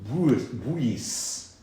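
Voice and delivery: put-on Old French "bouis, bouis" with a full "b" and every letter sounded; Old French word. Shown as a demonstration. Teacher reads the Old French name of boxwood, sounded out. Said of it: "he writes bosso".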